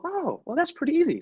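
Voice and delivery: high, hammed-up airy reply; lightly mocking self-impression; mock breezy boss. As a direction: toss it off in a silly high voice. Mocking his own easy reply.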